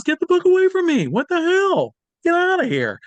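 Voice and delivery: very high, loud imitated yell; angry yell. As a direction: chase the kid away at full blast. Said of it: "and he says".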